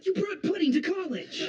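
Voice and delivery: high, drawn-out incredulous accusation; slow it down in horrified disbelief; appalled disbelief. Acted. Friend catching his roommate with pudding, appalled disbelief.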